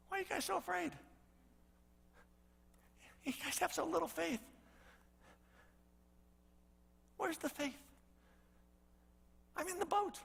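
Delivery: quiet reassurance with a touch of disappointment; calm Jesus. Acted. Jesus gently chides his frightened followers, soft and calm.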